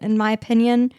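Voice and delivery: normal talking voice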